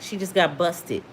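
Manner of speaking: ordinary talk, nothing marked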